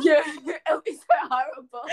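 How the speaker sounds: high laughing wail; giggling mock despair. Real, not acted. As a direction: laugh it out, voice breaking up. Laughing about imagined pregnancy fights.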